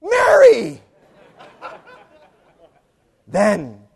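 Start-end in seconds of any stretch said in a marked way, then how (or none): 0.0-0.8 very high, loud cry of the name; exasperated shout. Acted. The Lord to Mary who won't see, exasperated. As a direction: shout the name to break through to someone. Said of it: "Exasperated, the Lord says".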